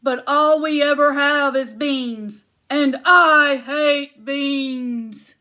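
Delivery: loud, slow booming chant; big giant stomping in for lunch. Acted. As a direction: boom each syllable like a giant. Giant complains.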